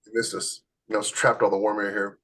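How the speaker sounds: ordinary talk, nothing marked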